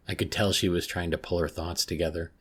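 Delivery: ordinary delivery, nothing marked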